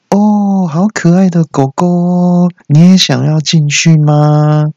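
high, drawn-out cooing at a dog; gushing woman. Acted. Woman greeting a cute dog at the door, gushing. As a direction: coo loudly and slowly at a cute puppy. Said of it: "女生看到我就说".

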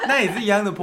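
high burst of laughter; laughing. Real, not acted. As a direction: laugh through the words. Laughing at his own joke.

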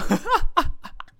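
short high rising laugh; high giggle. Real, not acted. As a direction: burst into a giggle. Laughing at the taunt. (高声短笑)

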